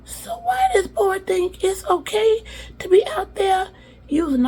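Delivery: put-on high, loud country-accented porch griping. Uncle gripes about Noah on the porch, country accent. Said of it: "I got this country accent".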